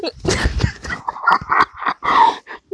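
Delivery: high breathy burst of laughter. Real, not acted. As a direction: crack up helplessly. Breaks character laughing.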